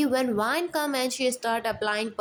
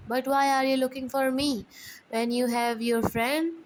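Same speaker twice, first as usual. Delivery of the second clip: ordinary delivery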